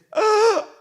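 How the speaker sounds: loud, high heaving gasps; imitated hyperventilating. Son upset in back seat, imitated hyperventilation. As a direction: suck in air in big panicky mock gasps. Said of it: "He's hyperventilating".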